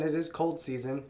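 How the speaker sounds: normal talking voice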